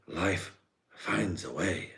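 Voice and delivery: slow, soft, drawn-out quote; slow dreamy film-quote delivery; Jeff Goldblum impression. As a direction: lightly mimic a languid movie scientist. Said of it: "Jeff Goldblum".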